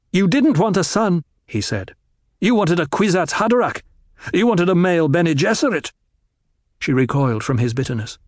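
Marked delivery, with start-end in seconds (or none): 0.1-5.9 higher, pressed accusing tone; bitter accusation. A narrator's reading. Paul accusing his mother of her plans, bitter. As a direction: throw it back at her.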